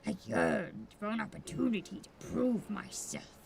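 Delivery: low brooding swordsman. Acted. Voices a rival swordsman.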